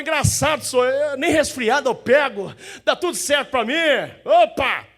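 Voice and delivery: high and loud cocky bragging; smug mock boast. Preacher mimicking a smug sinner to the church, cocky and loud. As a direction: brag like nothing can touch you.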